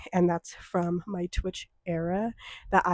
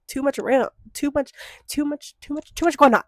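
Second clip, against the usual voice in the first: higher, rising, flustered repeats; overwhelmed babble; 7 semitones higher, 4 dB louder than usual. Retold or imitated. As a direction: pile the words up frantically. Imitating the busy shop.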